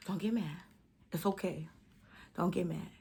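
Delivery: soft, low self-talk, barely there; calm yourself down under your breath; inner voice. Retold or imitated. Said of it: "I be saying in my head".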